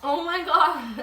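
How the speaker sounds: stunned, drawn-out oh my God; shocked gasp. Real, not acted. Client pops his leg off.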